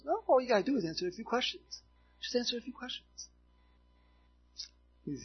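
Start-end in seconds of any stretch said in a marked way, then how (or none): 0.0-3.3 lightly voiced woman, pitched up; coaxing witch. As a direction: imitate her breezy persuasion, higher. Witch coaxes reluctant pastor in retelling.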